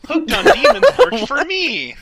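high, loud, slow slogan; hammy ad announcer. Acted. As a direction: sell it like a cheesy commercial.

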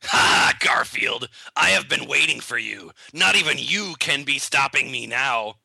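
low, slow gloating villain; lightly voiced cackling villain; evil villain. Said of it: "Ashton Kutcher cackled with evil".

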